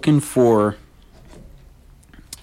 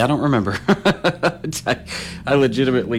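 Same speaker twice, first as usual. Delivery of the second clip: laughing; 4 semitones higher than usual. Real, not acted. Admits forgetting, laughs.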